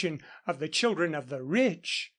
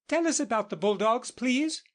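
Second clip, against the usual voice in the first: high, fast eager plea; children begging the teacher, quick and high; eager kids calling out together; children crying out; 7 semitones higher than usual. Acted. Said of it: "the most of them cried".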